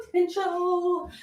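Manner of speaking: high drawn-out. Pretend. Echoes word high and drawn out.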